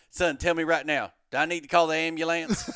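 a farmer urgently checking on a stranger; farmer asking. Retold or imitated. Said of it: "he looks at me, he goes".

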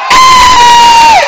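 very high loud cry of "Hey!"; triumphant shout. Acted. Preacher exulting over communion wine, high shout. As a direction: burst out like a cheerleader for the wine.

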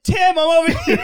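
high, loud comic scream with laughter. Retold or imitated. Calling his friend Tim over in the back yard, mock scream.

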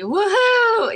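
high, loud cheer; a real-sounding shout of triumph; joyful whoop. Retold or imitated. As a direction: whoop for joy out in the street. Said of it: "And I was like".

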